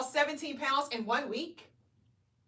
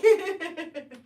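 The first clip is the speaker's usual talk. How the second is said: short laugh; 2 semitones higher, 3 dB louder than usual. Real, not acted. Laugh after age reveal.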